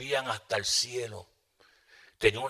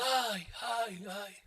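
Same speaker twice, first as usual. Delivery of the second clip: high soft mock wail of old sisters; a hint of the elder sisters' cries; lightly imitating old church women wailing; imitated wailing; 8 semitones higher, 10 dB quieter, much slower than usual. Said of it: "esas hermanas mayores de edad, gritando".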